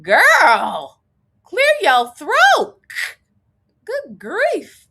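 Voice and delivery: high, loud mock exclamation; retold shout telling a girl to clear her throat; exasperated outburst. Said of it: "I was like".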